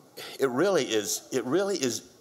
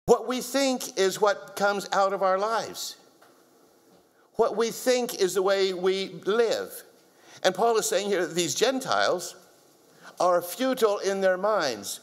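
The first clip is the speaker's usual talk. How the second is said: higher, insistent preacher voice; emphatic preaching; 4 semitones higher than usual. Acted. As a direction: drive each point home. Preacher building a point.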